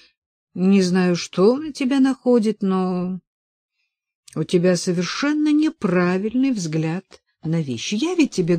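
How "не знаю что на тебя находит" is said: ordinary delivery, nothing marked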